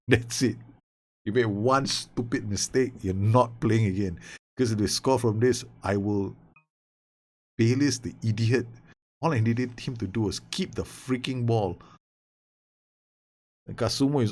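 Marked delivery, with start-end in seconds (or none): none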